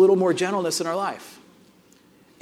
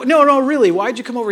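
louder, higher retold insistence; insistent pressing; 5 semitones higher, 7 dB louder than usual. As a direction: press him for the real reason. Pressing his son for the reason.

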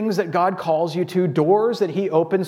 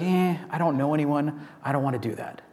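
quieter mock grumble, softer at the end; reluctant grumble; 3 semitones lower, 5 dB quieter than usual. Churchgoer avoiding a new event, mock reluctant grumble. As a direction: play the unwilling churchgoer, low-key. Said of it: "our natural thing would be like".

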